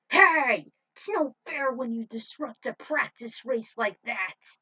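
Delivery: loud, indignant protest; angry shout. Acted. Tinsel confronting Luna over the disrupted race, angry shout. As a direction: yell it right in her face. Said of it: "Tinsel never backed down".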